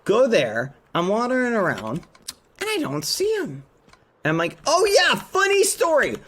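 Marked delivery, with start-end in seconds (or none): 4.7-6.2 high-pitched, bursting aside; animated recollection. Retold or imitated. Streamer remembers a story for chat, animated. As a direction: launch brightly into the funny anecdote.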